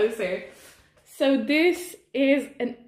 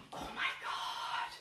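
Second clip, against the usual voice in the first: slow, breathy whisper of disbelief; awed whisper; 14 dB quieter, slower than usual. Real, not acted. Sees the second plant for the first time, awed whisper. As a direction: whisper it, stunned.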